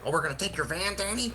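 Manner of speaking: a hint of a taunting buddy voice; mocking drunk friend. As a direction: teasingly quote the pushy friend, rising at the end. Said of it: "he's like".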